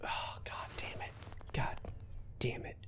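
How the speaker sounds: tense unvoiced whisper; scared whisper. Real, not acted. Sneaking through a dark tunnel, whispering in fear. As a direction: hush it out, afraid of being heard. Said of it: "I'm already scared".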